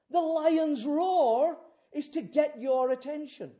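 high, loud, thundering sermon; full-force preaching. Real, not acted. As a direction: proclaim it with the force of a lion's roar.

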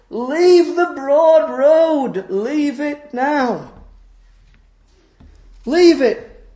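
high, drawn-out urgent cry; calling out. Acted. Roadside voice urging travellers off the broad road, calling out. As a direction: call slowly across a distance, pitched high. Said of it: "this voice calls out".

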